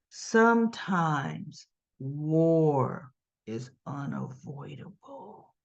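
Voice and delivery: very slow, low, fading to hushed; ominous declaration. Retold or imitated. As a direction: draw it out low and soft, like a grave warning. Acting Nina's ominous line.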